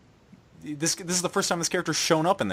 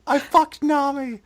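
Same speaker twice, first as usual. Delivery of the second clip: mocking voice; 11 semitones higher, 4 dB louder, slower than usual. Mock character confession.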